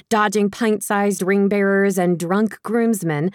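plain reading voice

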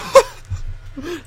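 sudden high wheezy laugh. Real, not acted. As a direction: burst out laughing. Bursts out laughing.

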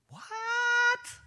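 high, loud disbelieving burst; shocked. Real, not acted. Preacher reacting to a harsh verse, shocked. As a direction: react as if you can't believe it.

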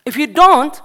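loud, rising sermon exhortation, hammering each phrase; full-force preaching. Acted. Preacher rousing young believers to evangelize Europe, loud preaching force. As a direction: preach it out like a revival call.

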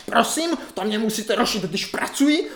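high, annoyed phone answer; irritated doctor. Retold or imitated. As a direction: scold the caller for interrupting work. (vysoký podrážděný hlas doktorky)

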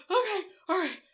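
high, choked-back mock composure; holding back tears. Mother watching strangers thank her Marine son, holding back tears. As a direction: act out fighting not to cry. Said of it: "trying so hard not to cry".

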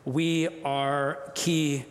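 very slow, spaced-out words; slow and measured, pausing before the last word; deliberate motto. Real, not acted. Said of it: "we say it like this".

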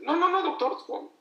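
high, loud panicked no no no; patient yelping high and loud at the doctor's words; alarmed protest. Retold or imitated.

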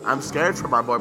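ordinary talk

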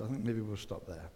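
ordinary delivery

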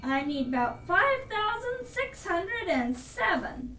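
high, defiant royal declaration; indignant boastful king. Acted. Yertle vows to outclimb the moon, indignant. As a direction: vow it grandly, refusing to be topped.

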